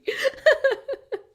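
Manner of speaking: loud squealing laugh; loud high laugh. Real, not acted. Laughs at Chicken Run joke.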